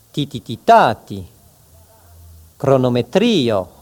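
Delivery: each word then morse "tati" with the last "ta" hit hard; stress on second-last syllable. Shown as a demonstration. Teacher drilling penultimate stress with morse rhythm. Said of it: "pour bien faire comprendre comment il faut accentuer".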